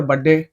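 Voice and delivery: "बर्थडे" said as "badde"; mispronounced birthday. Shown as a demonstration. Quoting people who skip "birth", "बर्थडे" slurred to "badde". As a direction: drop the r and th from "बर्थडे", doubling the d. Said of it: "बर्थ ना बोलकर".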